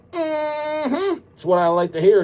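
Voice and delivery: high, squeaky approving mm-hmm; squeaky mock approval. Host approving a fan's plans with a squeaky high mm-hmm. Reacting to a fan letter.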